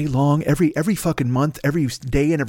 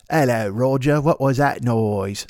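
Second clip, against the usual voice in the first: slow mock English accent; 2 semitones lower, slower than usual. Drummer calling to the singer, goofy mock British accent. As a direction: draw it out in a put-on British voice.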